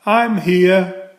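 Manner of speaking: high, puffed-out little "I'm here"; out of breath from running; a winded, eager arrival; huffing. A narrator's reading. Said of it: "huffed Franklin".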